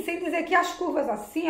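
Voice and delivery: ordinary delivery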